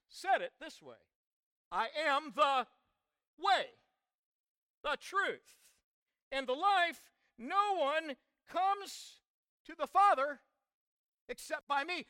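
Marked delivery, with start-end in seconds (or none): 1.7-12.0 emphatic, raised preacher's proclamation; preaching. Acted. Preacher quoting Jesus to the congregation, emphatic proclamation. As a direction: declare it with force, pausing between phrases.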